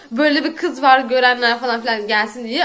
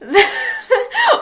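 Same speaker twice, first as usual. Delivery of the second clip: sudden high shriek of a laugh; incredulous cackle; high burst of laughter; 13 semitones higher than usual. Real, not acted. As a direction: break up laughing in disbelief. Bursting at the news.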